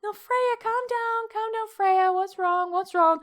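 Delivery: high pleading voice to the dog; soothing the dog. Retold or imitated. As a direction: coax a frightened puppy, high-pitched. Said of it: "So it was just like".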